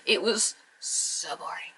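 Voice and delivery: whispered, slow and bored. Real, not acted. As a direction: breathe it out, utterly bored. Grumbling about the match.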